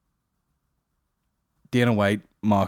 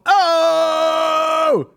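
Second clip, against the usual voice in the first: shrill-high hammed-up oh; exaggerated fake reaction cry; high mock shout; 8 dB louder than usual.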